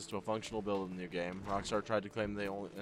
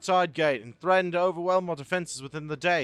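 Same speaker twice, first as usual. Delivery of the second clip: higher, louder breathless soldier report; urgent report; 6 semitones higher, 7 dB louder than usual. Acted. Soldier reporting an attack to the commodore, higher louder urgent report. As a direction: rush the bad news to your commander.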